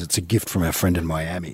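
soft low menacing line; hushed mafia messenger; low quiet gangster. Retold or imitated. Quoting Johnny Ola, Godfather Two.

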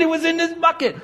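very high-pitched breathless-with-news reply; excited answer. Retold or imitated. Finder answers the crowd about his bucket, excited and high. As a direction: keep the voice way up with excitement.

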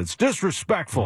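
imitating Tony La Russa. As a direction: mimic the manager's clipped complaint to reporters. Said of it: "and he said".

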